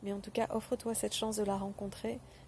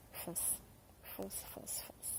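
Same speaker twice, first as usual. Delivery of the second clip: whispered urging; 16 dB quieter, much slower than usual. Real, not acted. Urging listener to go for it.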